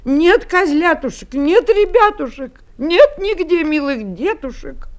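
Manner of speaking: high, urgent mother's wail; distressed lament. Acted. Mother goat finding her kids gone, distressed wailing. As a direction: cry out high, despairing for lost children.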